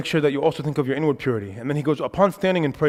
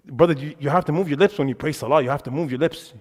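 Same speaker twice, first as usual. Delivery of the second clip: normal talking voice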